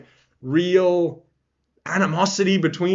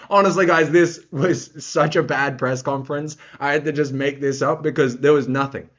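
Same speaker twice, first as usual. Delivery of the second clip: ordinary talk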